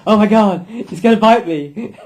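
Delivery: high, loud panicked yelp through laughter; mock panic. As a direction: ham up the terror of being bitten, laughing. Scared person panics at dog.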